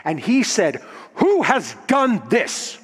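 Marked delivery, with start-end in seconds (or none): 1.1-2.8 high, slow, thundering demand from an angry god; angry Zeus. Acted. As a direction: demand the culprit in godly outrage. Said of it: "he was angry".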